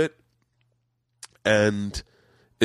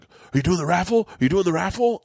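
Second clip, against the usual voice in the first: higher-pitched imitation of eager fans; eager audience member; 5 semitones higher than usual. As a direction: pester him with a rising question. Imitating audience asking for the raffle.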